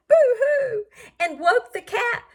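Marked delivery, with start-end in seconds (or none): 0.1-0.8 high, louder hammed-up sob of the rat; mock crying boo-hoo. Reading the scared rat's cry, a playful mock boo-hoo. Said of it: "who cried".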